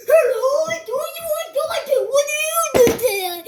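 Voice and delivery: Walk Johnson voice. Acted. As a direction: wail every line up high and loud. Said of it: "my final voice. It's the Walk Johnson".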